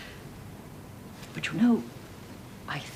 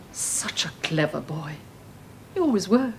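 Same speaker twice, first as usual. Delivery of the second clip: normal talking voice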